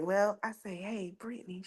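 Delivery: hushed call in the dark; soft, barely voiced; whispering. Whispering to sister at night.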